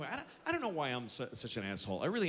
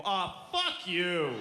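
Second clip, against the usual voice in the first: dismissive parent; much slower than usual. Retold or imitated. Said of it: "They go".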